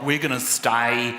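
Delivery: slow, stretched Aussie drawl; Australian accent. Retold or imitated. Australian lingering on vowels, slow exaggerated Australian accent. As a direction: hang on every vowel like a lazy Aussie. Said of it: "they're just like".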